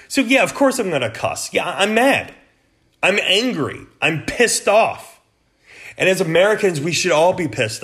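"I'm mad", "I'm angry", "I'm pissed off" said high and hot; real anger. Real, not acted. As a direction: let the anger out, pitch up.

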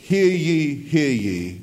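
booming, mock-grand voice of God; Charlton Heston as God. Preacher parodies how people think God speaks, booming mock-grand proclamation. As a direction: proclaim it like an epic-film God, pitch falling. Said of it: "God always speaks like Charlton Heston".

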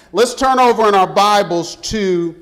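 ordinary talk

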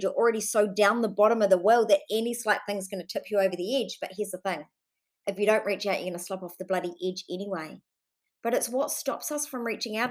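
ordinary delivery

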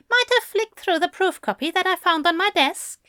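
high, quick evasive author; Fell admitting. Acted. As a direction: rattle off a casual admission up high.

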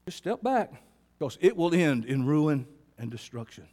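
ordinary delivery

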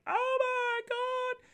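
high, slow drawn-out oh my God; stunned. Retold or imitated. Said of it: "and I'm like".

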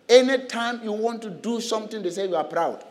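usual speaking voice

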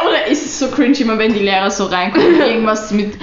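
ordinary talk, nothing marked

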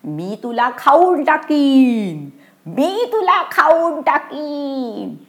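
wailing monster threat. Acted. Monster slides down threatening to eat the children, high slow wail. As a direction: stretch it into a long eerie wail. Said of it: "आणि ओरडू लागली".

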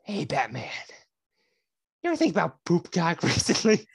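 mock villain, breaking into a laugh; halting Joker greeting with a long pause. Friend imagining Joker approaching Batman, lightly voiced Joker. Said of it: "Joker walking up to Batman".

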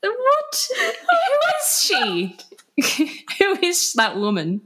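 high, squeaky laughing through the words; can barely speak for laughing; helpless laughter. Real, not acted. Cracking up over her own behaviour.

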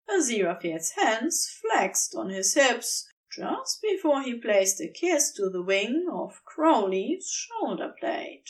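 ordinary delivery, nothing marked

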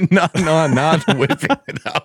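laughing denial. Real, not acted. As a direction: laugh while protesting.

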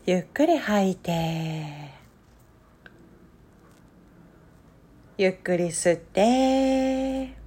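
drawn-out, hushed breath pacing; very slow breathing cues. Acted. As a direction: stretch each word like a long breath.

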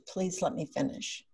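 soft, gentle "Please let me finish"; gentle reminder. Shown as a demonstration. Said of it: "that whole tone of being supportive".

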